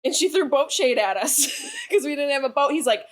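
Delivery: ordinary delivery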